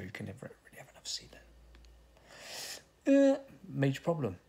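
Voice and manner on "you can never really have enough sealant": quiet fast whisper under the breath; whispered aside. Real, not acted.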